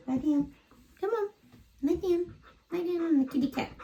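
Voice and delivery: high sing-song coaxing of a pet; coaxing dog talk. Real, not acted. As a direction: coo at the dog to settle him down. Coaxing her dog to lie down.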